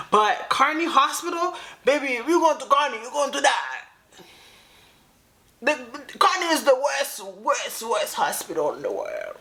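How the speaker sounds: high, loud, fired-up warning about the hospital; worked-up rant. Real, not acted. Host warning viewers off Kearney, loud and high-pitched. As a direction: sound off at it like it's a scandal.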